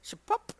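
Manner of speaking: high squeaky slurp; slurp noise. Pretend. Voicing a comic's sound effect, high slurp. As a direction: make the slurp sound silly.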